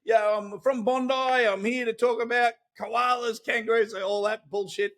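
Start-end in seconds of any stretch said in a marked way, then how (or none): 0.0-3.9 put-on broad Australian accent. Leaving a cheeky voicemail pitch for a New York radio show, hammed-up broad Australian. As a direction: lay the Aussie accent on thick like a bush larrikin. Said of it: "most colloquial Australian, um, bushwhacking message ever".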